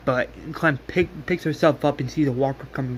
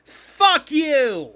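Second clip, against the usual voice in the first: high, drawn-out furious scream; all-out retold scream of rage; 13 semitones higher, 6 dB louder, much slower than usual. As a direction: scream the name, stretched out, then curse her. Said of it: "Sophie screams".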